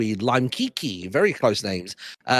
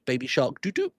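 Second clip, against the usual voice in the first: ordinary talk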